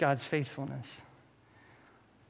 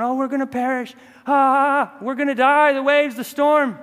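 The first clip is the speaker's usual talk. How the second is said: loud, high panicking fishermen; mock panic; 7 semitones higher, 8 dB louder than usual. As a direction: wail in exaggerated terror of drowning. Acting fishermen panicking in a storm.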